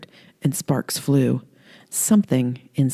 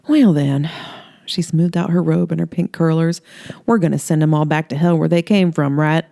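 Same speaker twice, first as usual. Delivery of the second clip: flirty Holly. Acted.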